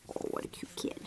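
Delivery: fond whisper. Real, not acted. Owner whispering over a cute puppy. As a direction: breathe it tenderly.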